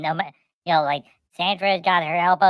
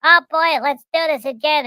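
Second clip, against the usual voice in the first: put-on high, loud little girl; excited little girl; 9 semitones higher, 6 dB louder than usual. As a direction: squeal it like a thrilled kid. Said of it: "the granddaughter ... like".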